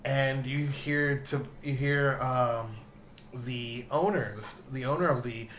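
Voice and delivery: ordinary talk, nothing marked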